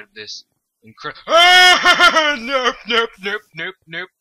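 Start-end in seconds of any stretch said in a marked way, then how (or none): 0.8-2.4 a sudden laughing shriek, much higher and louder; jolted by a monster, she laughs and screams; startled scream. Real, not acted. Gamer startled in a dark cave, shrieking. 2.4-4.1 rapid nopes, higher and louder, fading down; backing away fast from the monster; panicked nopes. Real, not acted. Fleeing a cave mob, panicked repeated nopes.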